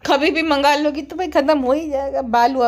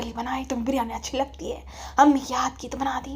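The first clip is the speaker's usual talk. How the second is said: soft-spoken mother; 2 semitones lower, 8 dB quieter than usual. Acted. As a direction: play a mild mother, half whispering. (धीमी, दबी आवाज़ वाली अम्मी)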